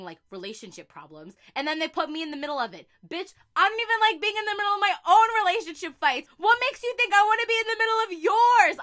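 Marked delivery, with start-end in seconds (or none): 3.1-8.8 fast, higher and louder, snapping at friends; quick, loud, indignant comeback; sassy rant. Acted. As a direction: tell them off with attitude. Ranting at friends.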